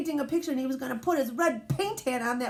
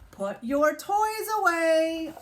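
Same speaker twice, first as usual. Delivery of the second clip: stern mom; 6 semitones higher, slower than usual. Acted. Reading mom's command.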